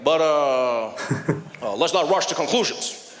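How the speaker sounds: halting, backpedalling presidential address; Barack Obama impression. Comic plays president backing off, halting statesman impression. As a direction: stall with measured pauses like a cautious statesman. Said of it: "Obama was like".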